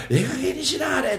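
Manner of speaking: high mock nagging cry; scolding wife. Husband mimics his wife's scolding.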